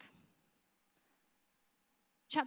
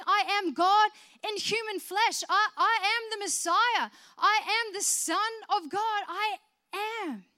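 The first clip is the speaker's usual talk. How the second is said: higher and louder, fervent proclamation; full-force preaching; 9 semitones higher, 6 dB louder than usual. Acted. Preacher proclaiming Jesus to the congregation, fervent. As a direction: lift the voice and proclaim.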